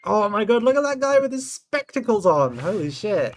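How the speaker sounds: loud, high delighted shout; thrilled at the funny portrait; excited outburst. Real, not acted. Player spotting a man in spectacles, shouting with delight.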